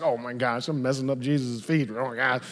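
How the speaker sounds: low, soft fretting aside; mock dismay. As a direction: imitate her fretting under the breath. Acting the woman's dismay.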